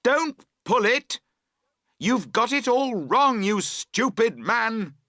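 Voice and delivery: high, loud and slow furious yell; furious cry. Acted. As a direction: bellow it at the clumsy partner. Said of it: "you cry".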